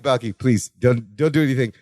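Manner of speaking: low, subdued imitation of a plea; pleading. Larry begging Balky not to touch him, low pleading.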